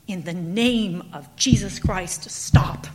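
slow loud cry from the floor; dying yell. Acted. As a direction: call out while bleeding. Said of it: "he was still yelling".

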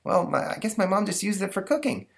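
put-on boy voice. Stanley answers the ghost.

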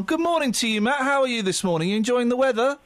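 ordinary talk, nothing marked

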